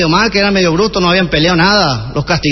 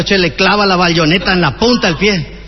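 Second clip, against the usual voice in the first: ordinary delivery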